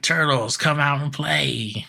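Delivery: mock villain calling out, stretching the words; imitated yelling. Krang challenging the turtles outside the studio, imitated yell. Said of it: "like, yelling".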